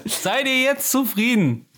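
slow, high mock-grand call; theatrical arena voice. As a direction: proclaim it like a gladiator. Theatrical outro after sign-off.

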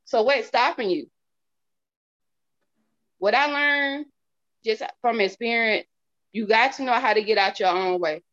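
usual speaking voice

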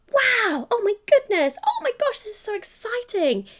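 high squealing excitement; thrilled. Retold or imitated. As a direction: gush like the money just landed. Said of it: "What would the energy of that be".

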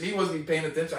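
usual speaking voice

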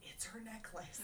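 whispered aside, voice dropped to breath; a playful whispered reassurance; mock reassuring whisper. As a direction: hush it like a soothing secret. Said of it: "to be like".

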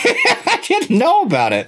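high, slow laughing protest. Real, not acted. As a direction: say it through a laugh. Jokes about doing a porn.

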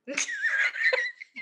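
shrieking laugh; high squealing laugh. Real, not acted. Laughing after telling story.

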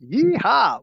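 high, hammed-up Western holler; cowboy yeehaw. Host joining the bad cowboy accent bit with a hammed-up yeehaw. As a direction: whoop like a cartoon cowboy. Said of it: "obligatory to do a really bad accent".

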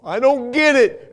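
high, loud, drawn-out cry; frustrated outburst. Acted. Believer telling God it's painful, exasperated shout. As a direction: burst out in exasperation.

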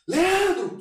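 calling out Leandro at the top of his voice; shouting a name. Retold or imitated. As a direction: yell Leandro to get his attention over the noise. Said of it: "Vou ter que gritar".

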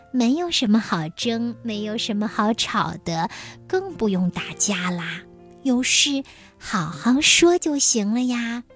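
plain reading voice

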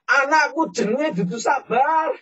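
high, loud, slow Javanese outburst; grieving angry retort. Retold or imitated. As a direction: snap back through grief. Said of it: "Dalam kondisi nangis, marah dia". (bentakan marah sambil menangis)

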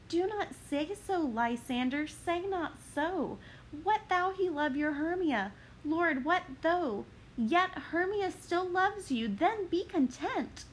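calm him down anxiously; Helena pleading. Acted.

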